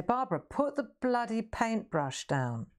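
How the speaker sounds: mock a posh art teacher telling her off. Posh teacher scolding Barbara to put the brush down, mock stern. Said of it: "She's quite posh".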